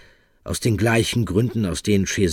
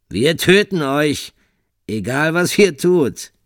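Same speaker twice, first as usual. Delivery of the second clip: slow, high, loud smug threat; 9 semitones higher, 7 dB louder, slower than usual. Acted. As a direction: savor every word of the threat. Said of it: "erklärte Michele suffisant".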